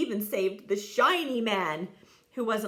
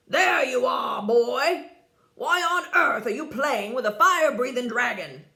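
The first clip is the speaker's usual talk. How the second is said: loud, scolding father's voice; father scolding his son for playing with a dragon; stern father; 7 dB louder than usual. A narrator's reading. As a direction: call the boy out sternly.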